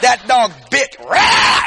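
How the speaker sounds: loud, slow and emphatic; slow shouted punchline. Real, not acted. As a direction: punch the line out slowly for laughs. Dog at the letter slot.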